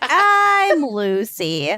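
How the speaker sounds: slow, sing-song self introduction; slow drawn-out intro. Acted. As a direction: stretch it out slowly.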